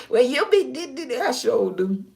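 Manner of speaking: high squealing laugh into words; loud laughing burst. Real, not acted.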